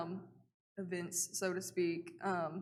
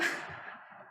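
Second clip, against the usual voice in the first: a quiet airy chuckle; little unvoiced laugh; soft breathy laugh; 4 dB quieter than usual. Real, not acted. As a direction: laugh under your breath. Laughs at own joke.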